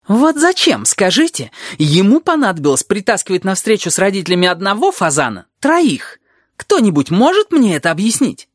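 high-pitched exasperated demand; venting indignation. A narrator's reading. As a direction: hurl the questions out, incredulous and cross. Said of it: "Ей необходимо было выплеснуть негодование". (выплёскивая негодование)

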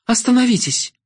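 high shouted plea; cry out. A narrator's reading. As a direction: scream it with eyes shut. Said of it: "выкрикнула".

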